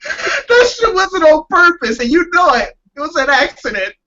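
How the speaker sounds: laughing protest. Real, not acted.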